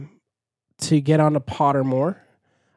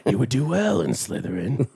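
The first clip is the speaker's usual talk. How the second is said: a put-on voice like the talking hat; lightly voiced old sorting hat; the hat tempting you toward Slytherin, put-on voice; 3 dB quieter than usual. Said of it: "It sounds like the Muppets one".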